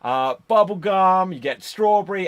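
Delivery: mock a wrestler belting out a clumsy promo; Ryback. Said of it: "And he's like".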